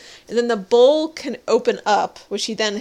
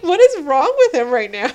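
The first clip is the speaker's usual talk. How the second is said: laughing, raised incredulous question; laughing disbelief; 6 semitones higher, 7 dB louder than usual. Real, not acted. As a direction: ask it cracking up in disbelief.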